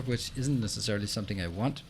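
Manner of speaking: ordinary delivery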